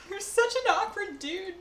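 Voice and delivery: high, slow affectionate tease; teasing pet talk. Real, not acted. Teasing her awkward dog, high and slow.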